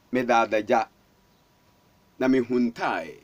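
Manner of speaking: low, softened retold admission; subdued confession. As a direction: sink low, owning up from hiding. Man confessing he hid, naked.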